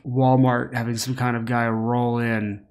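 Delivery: usual speaking voice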